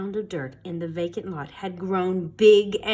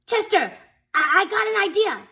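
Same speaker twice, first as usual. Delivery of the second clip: excited idea; 11 semitones higher, 3 dB louder than usual. Acted. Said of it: "Sammy explained".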